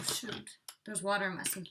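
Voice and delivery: ordinary talk, nothing marked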